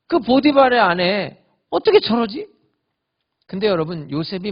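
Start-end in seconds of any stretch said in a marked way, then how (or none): 0.1-2.5 indignant. Pretend. Joseph's imagined complaint about Potiphar's wife.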